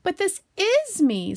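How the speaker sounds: high, loud, slow protest; very surprised. Acted. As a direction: protest in astonishment, drawn out. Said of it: "very much surprised".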